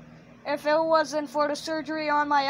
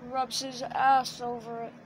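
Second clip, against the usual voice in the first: usual speaking voice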